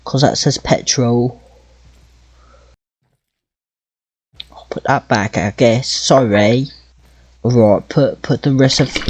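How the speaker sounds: half-hearted British accent while playing. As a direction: chat along in a mock British accent. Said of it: "Gonna do the rest of this with a British accent".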